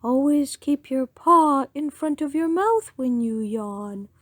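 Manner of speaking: a lightly voiced scolding owl. The owl lecturing the yawning rabbit, lightly hooted. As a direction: lightly put on a fussy old owl's advice. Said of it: "hooted the owl".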